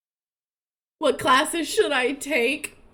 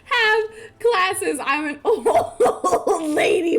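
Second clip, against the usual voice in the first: slow, laugh-broken words; words breaking up with laughter; helpless giggling while talking; laughing through words; 2 semitones higher, slower than usual. Real, not acted. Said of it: "I'm crying".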